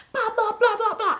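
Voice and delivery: high-pitched squawked blah-blah; mock arguing babble. Everybody going at it on the phone, mock squawking. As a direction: imitate a noisy argument in babble.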